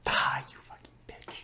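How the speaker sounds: breath-only hushed cursing; mock-betrayed whisper. Host cursing cohost for preferring other films, mock-betrayed whisper. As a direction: whisper the insult in playful disbelief.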